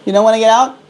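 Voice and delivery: very high squeaky pet voice; talking to a pet bird. Real, not acted. Calling bird out of cage.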